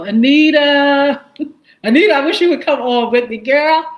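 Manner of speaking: loud, high, laughing call to Anita; excited greeting. Real, not acted. Excited shoutout to viewer Anita.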